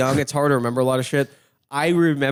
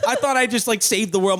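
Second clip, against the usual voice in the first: ordinary talk, nothing marked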